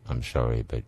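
half-joking hushed tragic announcement; mock tragic line. Mocking tearjerker song lyrics.

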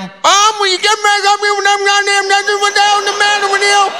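high-pitched, rapid-fire screamed tirade; screaming grandma. Retold or imitated. Grandma screaming at Grandpa Bob over her dentures, high screamed scolding. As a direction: imitate a furious grandma screaming, fast and very high. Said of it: "she was screaming at him".

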